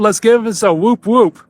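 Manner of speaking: ordinary talk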